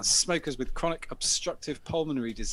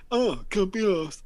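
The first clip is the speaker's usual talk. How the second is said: louder, slow sneering repeat of can't be arsed; mocking echo; 7 semitones higher, 6 dB louder, slower than usual. Lad repeating the narrator's excuse slowly and louder to mock him.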